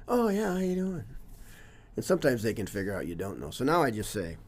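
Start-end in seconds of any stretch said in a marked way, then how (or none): 0.1-1.0 high, quick brush-off hello; breezy blow-off greeting. Retold or imitated. Coach faking recognition of a former student, high breezy hello. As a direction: breeze past it as if you know them. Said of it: "blow through it like".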